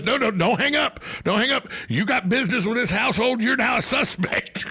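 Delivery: pin him down like a suspect; mock cop threat. [name] threatens the telemarketer.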